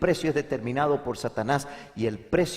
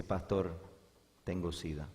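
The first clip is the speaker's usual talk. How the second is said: very soft, low, subdued admission; retold grim confession; 6 semitones lower, 10 dB quieter than usual. Man confesses illness to his pastor, hushed and low. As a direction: confess it quietly, voice sunk low.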